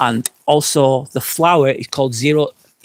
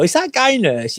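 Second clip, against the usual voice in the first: mock doubtful stranger; 10 semitones higher than usual. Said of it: "you go".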